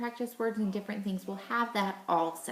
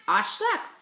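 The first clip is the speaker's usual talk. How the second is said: Aw jumps much higher and louder; excited aw shucks; 12 semitones higher, 5 dB louder than usual. Shown as a demonstration. Teacher playing the class response, high and excited. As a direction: squeal out aw shucks for the kids. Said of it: "I want you to say".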